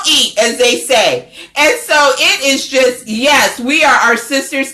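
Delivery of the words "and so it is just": ordinary delivery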